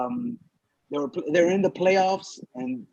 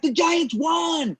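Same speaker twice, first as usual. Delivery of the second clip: high, drawn-out mock cheer; cheering crowd; 11 semitones higher, much slower than usual. Banquet crowd learning the Giants won, mock jubilant cheer. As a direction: imitate fans breaking the big news.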